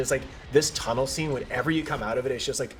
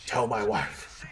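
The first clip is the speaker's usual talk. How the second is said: slow, drawn-out farewell; mock-tragic final message; dying last words; 3 semitones higher, much slower than usual.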